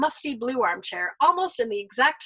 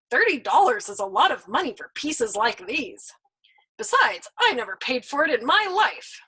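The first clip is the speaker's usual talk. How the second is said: doubtful and grudging, a little louder; grudging skeptic; 7 dB louder than usual. A narrator's reading. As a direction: haggle like a reluctant buyer. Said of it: "skeptically with the air of a grudging connoisseur".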